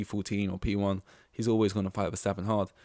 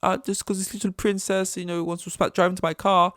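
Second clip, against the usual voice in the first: high whiny mock Verstappen, louder at the end; 10 semitones higher, 5 dB louder than usual. Rival driver mocking George as princess, high and whiny. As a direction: squeal it like a sulky rival driver. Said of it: "Verstappen calling George a little princess".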